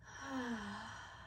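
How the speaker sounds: long, soft exhale of ease; soft sigh of release; relieved sigh. Shown as a demonstration. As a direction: let out a slow, easing sigh. Sighs to illustrate sense of ease.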